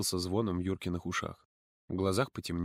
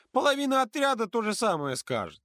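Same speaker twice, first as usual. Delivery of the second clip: put-on higher earnest girl's voice; earnest and worried, much higher; serious girl; 12 semitones higher, 3 dB louder than usual. A narrator's reading. Polina girl voice. (Полина серьёзно объясняет подруге)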